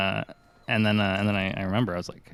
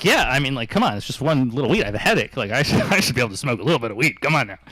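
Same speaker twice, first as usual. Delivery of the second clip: louder, higher, wheedling; whiny pleading; 4 semitones higher, 6 dB louder than usual. Retold or imitated. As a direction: coax her like a kid begging. Pleading with girlfriend to smoke.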